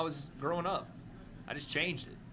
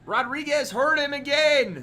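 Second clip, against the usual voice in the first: hyped slow yell; excited shout; 9 semitones higher, 10 dB louder, slower than usual. Real, not acted. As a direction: call the fight loud. Streamer yelling at fight.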